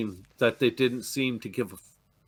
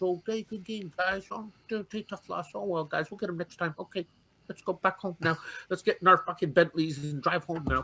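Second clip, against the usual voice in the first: softer, offhand indifferent footballer; mock careless player; 2 semitones higher, 6 dB quieter than usual. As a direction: toss it off softly like nothing matters. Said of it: "Just slowly, eh, counter off the pitch".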